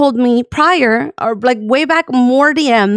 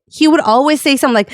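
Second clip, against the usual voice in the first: ordinary delivery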